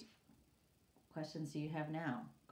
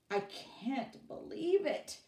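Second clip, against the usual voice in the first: plain reading voice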